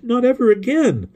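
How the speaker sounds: put-on very high, wailing child's despair; sobbing. A narrator's reading. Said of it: "she sobbed".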